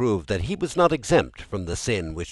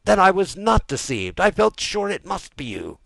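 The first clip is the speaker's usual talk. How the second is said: high-pitched, raised-voice exclamation; excited cry; 14 semitones higher, 7 dB louder than usual. A narrator's reading. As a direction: exclaim it as a suspicion confirmed. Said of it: "cried Villefort".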